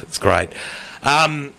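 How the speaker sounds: ordinary delivery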